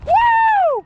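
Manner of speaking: high loud whoop; high-pitched party whoop; hype whoop. Real, not acted. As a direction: let out a big excited whoop. Hype shout.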